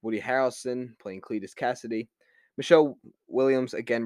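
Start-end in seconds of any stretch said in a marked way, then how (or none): none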